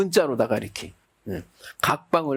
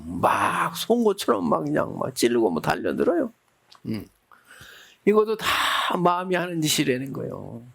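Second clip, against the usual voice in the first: ordinary talk, nothing marked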